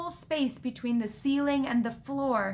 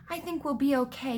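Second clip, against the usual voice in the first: ordinary delivery, nothing marked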